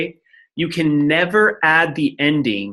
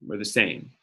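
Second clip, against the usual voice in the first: softer, lower repeat of were the same; quiet echo; 5 semitones lower, 8 dB quieter than usual. Shown as a demonstration. Teacher softly repeating the rule while writing it down. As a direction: murmur it back to yourself while writing.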